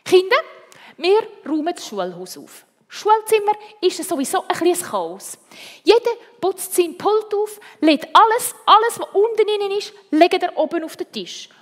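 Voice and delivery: loud, high teacher's instructions; rallying the class. A narrator's reading. Teacher tells the children to tidy the schoolhouse, rallying. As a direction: call out orders to a whole classroom.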